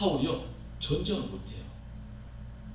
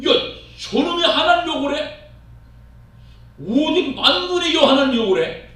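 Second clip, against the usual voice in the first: much higher and louder indignant cry; outraged shout; 11 semitones higher, 8 dB louder than usual. Acted. David outraged that the giant insults God, shouting. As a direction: roar at the blasphemy like furious young David.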